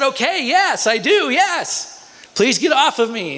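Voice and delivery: very high, drawn-out yes, acting the kid; desperate surrender. Pinned kid converts to end the beating, acted desperate surrender. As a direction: give in with a high, mock-frantic plea.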